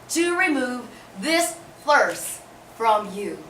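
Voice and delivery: usual speaking voice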